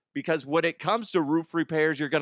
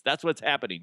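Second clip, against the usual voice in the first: ordinary delivery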